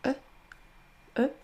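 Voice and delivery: E said as a soft, relaxed uh; a barely heard uh; soft schwa. Shown as a demonstration. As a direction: let the E fall out gently, lips loose. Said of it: "c'est un son E assez doux".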